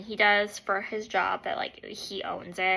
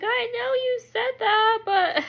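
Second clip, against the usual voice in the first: high, pleading protest; whiny excuse; 12 semitones higher, 4 dB louder than usual. Retold or imitated. Wife excusing herself to husband, high whiny protest. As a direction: whine it up high.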